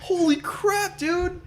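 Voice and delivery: high shocked exclaim; surprised. Real, not acted. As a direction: squeal it out in shock. Reacting to shark kill.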